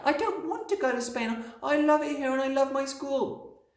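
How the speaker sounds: put-on very high, loud child's voice; high-pitched childish protest; crying out in protest. A narrator's reading. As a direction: plead like an upset little girl. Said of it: "Matilda cried out".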